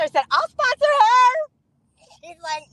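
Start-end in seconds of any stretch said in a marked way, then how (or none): 0.3-1.5 loud, high-pitched holler; eager mock volunteering. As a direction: call out high and loud over the group. Calls out volunteering to sponsor.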